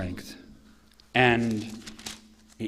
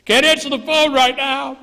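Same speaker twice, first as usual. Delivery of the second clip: high, loud mock call; comic strained shout; 13 semitones higher, 11 dB louder than usual. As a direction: yell it in a silly high voice. Acting out god on the toilet.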